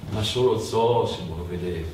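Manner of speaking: ordinary talk, nothing marked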